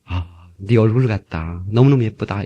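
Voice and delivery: low, soft, slow mock sweet talk; Seoul boyfriend. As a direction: murmur it like a smitten Seoul man. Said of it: "서울에 있는 핸섬한 남자친구가". (낮고 느끼한 서울 남자 흉내)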